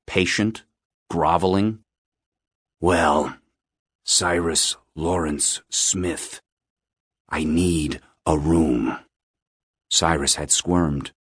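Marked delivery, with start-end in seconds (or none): 2.8-9.0 low, slow, thick-breathed caller; low-voiced man. A narrator's reading. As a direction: draw it out low, as if under a blanket. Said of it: "The man's voice was low, his breath thick".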